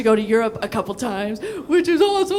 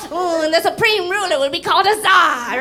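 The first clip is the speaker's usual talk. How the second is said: higher, louder pompous proclamation; mock official; 5 semitones higher, 4 dB louder than usual. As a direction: proclaim it like a pompous tiger ruler. Mocking Russian government voice.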